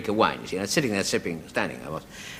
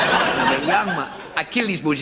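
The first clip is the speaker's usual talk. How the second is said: slow, put-on Spanish accent; mock Spanish waiter; 5 semitones higher, slower than usual. Barman boasting to the comic, in a mock Spanish accent. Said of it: "I sound like a waiter on the Golden Egg".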